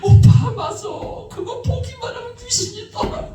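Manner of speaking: high, overjoyed with a laugh; bubbling over with relief; delighted. Retold or imitated. Sister thrilled that her brother will watch, overjoyed and high. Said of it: "그냥 좋아가지고 웅절절 모르는 거야".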